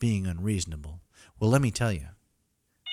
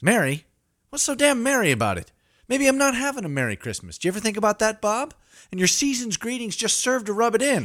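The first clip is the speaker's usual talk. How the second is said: loud bitter rant, pitched up; angry outburst; 13 semitones higher, 7 dB louder than usual. Acted. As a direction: tear into him about Christmas. Snapping at coworker Bob.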